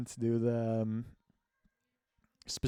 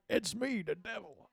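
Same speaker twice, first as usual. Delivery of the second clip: much higher squeaky devil, fading softer; 9 semitones higher, 4 dB quieter than usual. Acted. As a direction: pitch it way up as a silly devil. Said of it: "a troll".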